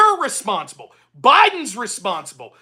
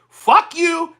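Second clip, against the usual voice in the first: angry outburst; 6 semitones higher, 4 dB louder than usual. Real, not acted. Ranting at Rick.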